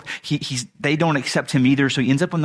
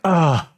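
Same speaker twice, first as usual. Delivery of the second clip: higher-pitched mock "ugh"; exasperated sigh; 4 semitones higher, 4 dB louder than usual. God answering a reluctant Moses, exasperated sigh. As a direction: act out a put-upon divine sigh. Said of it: "'Cause that's how he sighs".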